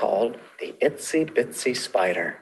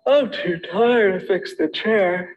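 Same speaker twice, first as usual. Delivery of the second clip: sleepy Goldilocks; 5 semitones higher than usual. A narrator's reading. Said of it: "Goldilocks said".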